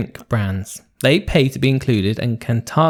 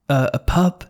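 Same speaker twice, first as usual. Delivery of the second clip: normal talking voice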